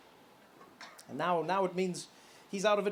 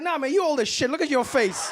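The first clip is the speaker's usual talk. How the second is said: louder, higher mocking insult; heated mock insult, higher and louder; trash talk; 6 semitones higher, 4 dB louder than usual. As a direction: roast him with swagger. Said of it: "It's like".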